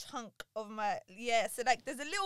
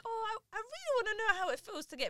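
put-on high-pitched wishful kid voice; younger self; 9 semitones higher, 8 dB louder than usual. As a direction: imitate your naive younger self, pitched way up. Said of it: "when I was young".

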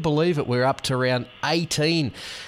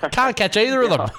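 laughing through the words, pitched up; 10 semitones higher, 4 dB louder than usual. Real, not acted. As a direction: giggle while admitting it. Host laughs at own tips.

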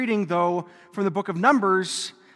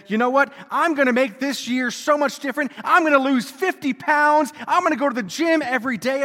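higher, louder, pumped-up vows; mock gung-ho resolve; 6 semitones higher, 5 dB louder than usual. Priest imitates people making New Year's resolutions, mock gung-ho. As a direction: rattle off big promises with hammed-up zeal. Acting out eager resolution makers.

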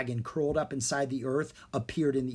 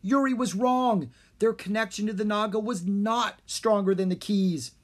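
worried; 7 semitones higher, 3 dB louder than usual. A narrator's reading. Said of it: "he said".

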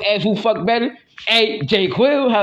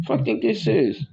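ordinary delivery

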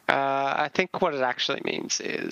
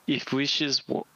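mock Russian accent; slower than usual. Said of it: "I'll say it with an insulting Russian accent".

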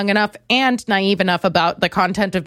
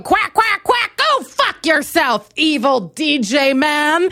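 high, drawn-out quacking protest; vengeful duck; 6 semitones higher, slower than usual. Acted. Duck takes revenge on evil DJ, angry quacking. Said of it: "And I was a duck".

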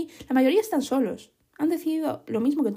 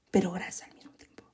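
whisper; 3 semitones lower, 4 dB quieter than usual. Real, not acted. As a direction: drop to a sudden whisper. Confiding odd scalp detail. (susurro repentino)

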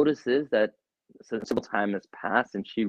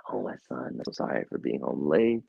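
soft, low, tearful apology; tearful mother; 8 semitones lower, 5 dB quieter than usual. Acted. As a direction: apologise softly through tears. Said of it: "she's in tears".

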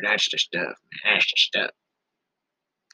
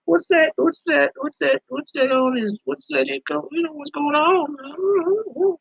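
Mama Bear voice; 13 semitones higher, slower than usual. Retold or imitated. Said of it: "And she's like".

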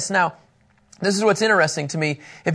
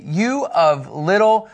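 slow, weighty rebuke of the disciples; Jesus rebuking; slower than usual. Retold or imitated. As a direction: draw it out like a patient but exasperated teacher. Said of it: "Jesus said in verse eight".

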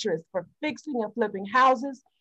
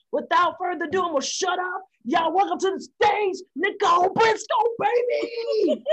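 hype announcer intro; 5 semitones higher than usual. Real, not acted. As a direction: bring her on like a hype man. Introducing guest to stage.

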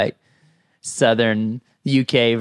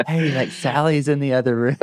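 urgent, raised warning under the breath; hushed warning; 4 semitones higher than usual. Retold or imitated. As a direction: tip him off fast. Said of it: "And you're like".

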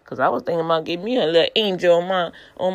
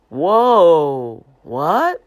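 loud, drawn-out stunned whoa; loud astonished whoa what; amazed whoa; 10 dB louder than usual. Real, not acted. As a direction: blurt it out in disbelief. Amazed God danced with him.

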